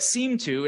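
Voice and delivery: seem to pushed up high and loud; stress on seem to. Shown as a demonstration. Speaker to audience, stressing seem to with pointed doubt. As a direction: lean hard on seem to, casting doubt. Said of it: "I'm gonna stress the word seem to".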